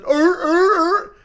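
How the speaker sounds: high, loud barking seal "er er"; seal bark imitation. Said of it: "Just that".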